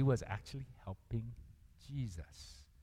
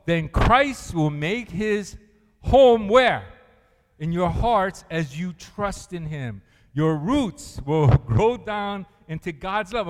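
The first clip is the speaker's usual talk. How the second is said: slow, much louder reading aloud in unison; rally the congregation through the verse; loud slow group reading; 3 semitones higher, 9 dB louder, slower than usual. Shown as a demonstration. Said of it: "Let's read it together".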